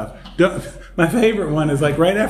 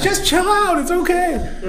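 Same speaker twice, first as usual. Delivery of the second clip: playful reassurance. Retold or imitated. Jesus calming the women at the tomb, high and laughing. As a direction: soothe them with a big grin in the voice. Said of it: "It's like".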